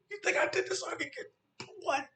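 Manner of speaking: very high, rushed disbelief; indignant squeal of fury; livid. Retold or imitated. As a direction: race through it in high-pitched outrage. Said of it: "I'm livid".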